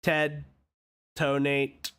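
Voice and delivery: low, even robotic monotone. Acted. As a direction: speak like a machine. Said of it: "what a strange robotic voice you have".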